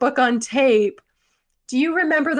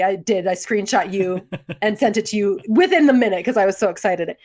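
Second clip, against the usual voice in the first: normal talking voice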